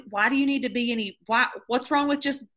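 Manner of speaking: ordinary talk, nothing marked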